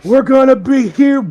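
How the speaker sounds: put-on punch-drunk grin. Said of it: "and I'm like".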